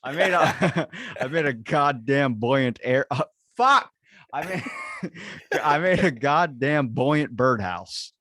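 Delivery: giggling, stumbling over the words; slow, halting tries broken by laughter; laughing through a tongue twister. Real, not acted. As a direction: keep cracking up mid-line. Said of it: "It was a tongue twister".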